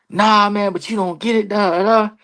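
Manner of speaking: high-pitched retold objection; upset protest. Wrestler refusing the veteran's reassurance, upset protest.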